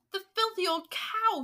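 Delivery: high, loud, outraged insult; angry shout. A narrator's reading. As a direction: shout it in indignation. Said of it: "he shouted".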